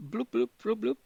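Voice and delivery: bubbly bloop sounds. Pretend. Imitating underwater bubbles.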